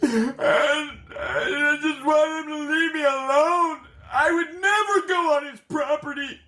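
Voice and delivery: high, rising mock wail; imitating a hammed-up tearful plea; fake crying. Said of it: "the fake crying goes on again".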